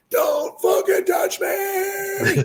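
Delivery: high, slow, shrill mocking yell of a stranger; mock screaming woman. Mocking a stranger who yelled at him in a shop, hammed-up shriek. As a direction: drag it out in a hammed-up screech, laughing. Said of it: "she yells at the top of her lungs".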